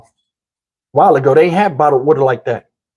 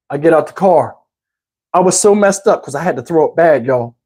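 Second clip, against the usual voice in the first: ordinary talk, nothing marked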